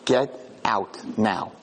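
low, slow, drawn-out order; slow stern warning. Retold or imitated. Retold angel ordering the soldiers out, slow and stern. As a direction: spell out every word with grave weight.

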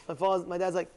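ordinary delivery, nothing marked

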